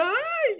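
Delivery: high laughing cry of alarm; a shocked little ay, laughing; dismayed laughing ay. Real, not acted. Reaction to dog food. (ay alarmado entre risas)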